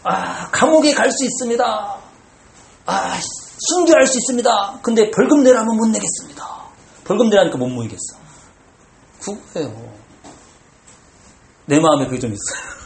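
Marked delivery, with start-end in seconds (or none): 0.0-6.6 mock pious bravado. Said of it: "그 말 이해 못 하겠죠".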